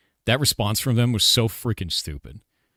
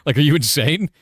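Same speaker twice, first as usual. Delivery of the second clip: incredulous; 6 semitones higher, 6 dB louder than usual. Real, not acted. Angry rant about the devs.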